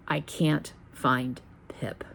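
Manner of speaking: breathy dread, barely voiced, slow; slow hushed whisper of the words no dog mom wants. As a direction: whisper it like terrible news. Roommate's dreaded words retold.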